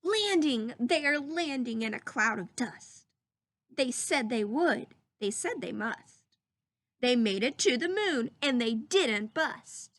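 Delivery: put-on lively storybook reading for children; read-aloud voice. Teacher reading a story to her class, lively read-aloud. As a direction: read it like a picture book to the class.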